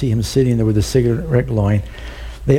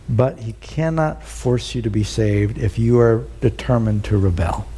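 normal talking voice

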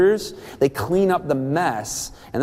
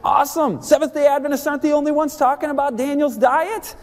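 higher, gushing excitement; thrilled; 9 semitones higher than usual. Retold or imitated. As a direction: gush like it's the best news. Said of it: "And I was thrilled".

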